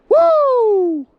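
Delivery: very high, loud "woo"; long shrieked victory whoop; hyped whoop. Real, not acted. Angler whooping over his catch, hyped. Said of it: "I'm sorry I'm so hype".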